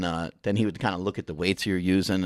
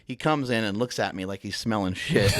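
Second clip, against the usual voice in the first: usual speaking voice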